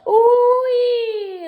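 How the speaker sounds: high, loud doll squeal of joy; delighted squeal. A narrator's reading. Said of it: "the doll squealed".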